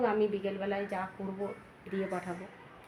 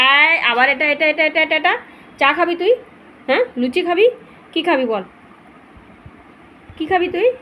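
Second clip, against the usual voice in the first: high sing-song pet talk; talking to the dog; 6 semitones higher, 12 dB louder than usual. Real, not acted. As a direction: coax the dog, high and loud. Coaxing the dog to eat.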